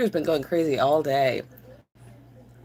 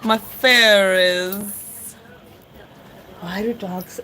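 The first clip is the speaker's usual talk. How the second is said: ordinary talk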